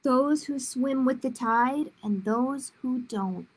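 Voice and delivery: ordinary delivery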